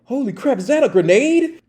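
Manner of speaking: high-pitched alarmed disbelief; shocked reaction. Retold or imitated. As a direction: imitate a friend stunned by what he sees. Acts colleague's shock at the grenade.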